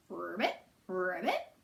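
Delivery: low, soft croaking ribbit; low soft frog calling to Turtle. Acted. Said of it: "Froggy called to Turtle".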